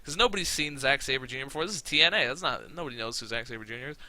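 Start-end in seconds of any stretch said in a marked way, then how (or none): none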